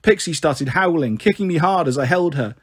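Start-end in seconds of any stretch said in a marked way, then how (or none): none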